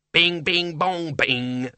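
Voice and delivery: imp imitating a clock chime; sing-song bings, starting high; chiming demon. A narrator's reading. Watch demon announcing the hour, chiming.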